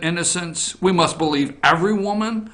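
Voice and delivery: usual speaking voice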